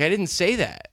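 acted-out defensive retort; indignant denial. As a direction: imitate an exasperated girlfriend protesting. Retold girlfriend protesting the accusation.

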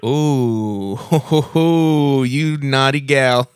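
flirty. Acted. Flirting on prank call.

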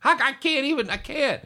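high, loud, flustered self-defense; dad caught out, making excuses to his wife. Retold or imitated.

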